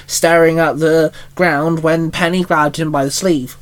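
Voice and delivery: usual speaking voice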